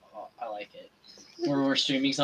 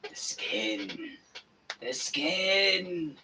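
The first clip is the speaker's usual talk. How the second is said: scary voice; 4 dB quieter, much slower than usual. Acted. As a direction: menace it like a horror villain. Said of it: "I like the scary voice I did".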